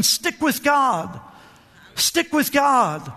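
drawn-out, earnest plea; slow urging. Real, not acted. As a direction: lean in and press it slowly. Preacher urging slowly.

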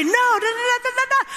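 hammed-up rant at the door; mock husband yelling. Wife imitating her yelling husband, mock rant. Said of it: "he would follow me ... and say".

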